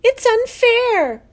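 high, loud, slow wail; sobbed protest. A narrator's reading. Daughter protests to father about the pig, sobbing. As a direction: wail it out in distress. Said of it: "she sobbed".

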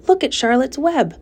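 put-on high, eager little girl; excited child. A narrator's reading. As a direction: Fern shows everyone the web, high and eager. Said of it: "cried Fern".

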